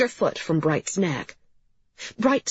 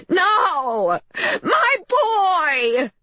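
high, loud anguished scream; shrill-pitched wailing cry of a frantic mother; 14 semitones higher, 5 dB louder, much slower than usual. A narrator's reading. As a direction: scream out in distress for your boy. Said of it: "screamed Missus Lanowitz".

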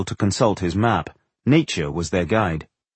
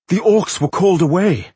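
high, louder theatrical announcement; dramatic report; 8 semitones higher, 5 dB louder than usual. A narrator's reading. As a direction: deliver alarming news with drama. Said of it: "to convey the drama".

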